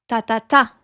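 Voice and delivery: third "ta" punched; French rhythm, weight on the final "ta"; two even "ta"s, then a strong one; last-syllable stress. Shown as a demonstration. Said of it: "you stress la dernière syllabe".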